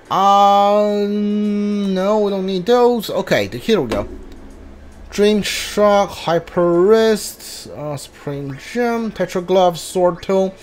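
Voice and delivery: ordinary talk, nothing marked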